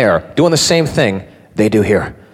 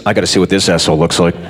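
inner thought; 11 semitones lower, much faster than usual. Retold or imitated. His inner thought, fast.

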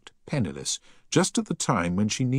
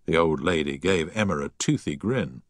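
ordinary delivery, nothing marked

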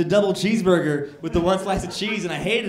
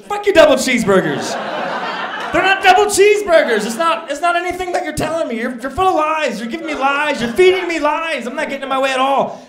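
shouting, much higher and louder, laughing through it; furious rant; 11 semitones higher, 10 dB louder than usual. Pretend. Imagined shouted tirade at the corporate rep.

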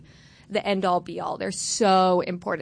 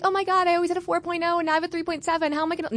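higher and fast, a whiny mock freak-out; mock overachiever panic; 9 semitones higher, much faster than usual. Mocking grade-obsessed classmates, fast mock panic. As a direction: parody a perfectionist panicking over a tiny drop. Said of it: "I don't mean like".

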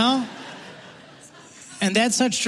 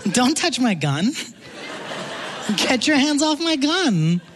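mock warning; 6 semitones higher than usual. Quoting someone in joke.

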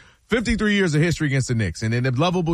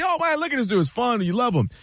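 much higher, thrilled mock fan voice; excited fan; 6 semitones higher than usual. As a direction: gush over the young star. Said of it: "and you see".

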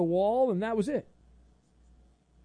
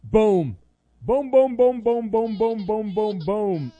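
loud rapid-fire volley of booms; booming; 3 semitones higher, 8 dB louder, slower than usual. Pretend. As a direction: punch out each boom, stepping down in pitch. Host gleefully fires off booms.

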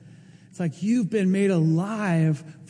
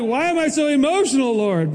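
higher and louder, a plea to heaven; pleading to God; 7 semitones higher, 4 dB louder than usual. Retold or imitated. Pastor retells asking God why he's so emotional, pleading. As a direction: cry out the question to the Lord. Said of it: "I just felt like".

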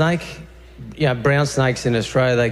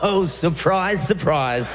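sarcastic; 3 semitones higher, slower than usual. Real, not acted. Sarcastic self-aimed jab.

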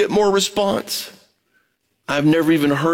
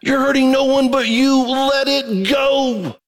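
passionate plea; 9 semitones higher than usual. Acted. Pleading with grudge holders.

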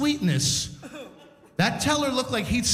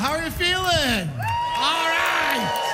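raised, excited host greeting; crowd greeting; 8 semitones higher than usual. Real, not acted. Host welcoming the Oshawa crowd, high and rousing. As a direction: pitch it up high to rouse the audience.

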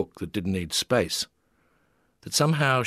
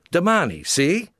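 higher, louder eager question; eager cry; 9 semitones higher, 4 dB louder than usual. A narrator's reading. Tourist miming words for an Italian, eager cry. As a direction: cry it out hoping he gets it. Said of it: "Callie cried".

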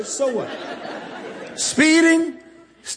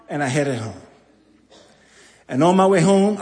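ordinary delivery

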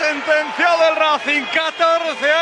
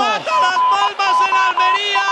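shouted goal call, rapid and pitched up; breakneck goal commentary; 4 semitones higher than usual. Real, not acted. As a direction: shout the goals out at full speed.

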